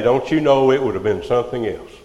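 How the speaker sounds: ordinary delivery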